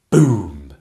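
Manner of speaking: loud mouthed boom; cannon boom. Acted. Narrator sounding the cannon firing, loud boom. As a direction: burst out the bang.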